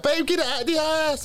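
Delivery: high-pitched mock pleading; mimicked girlfriend. Girlfriend begging her man to remove a spider, mimicked high squeal. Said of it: "but girl with a ninety, like".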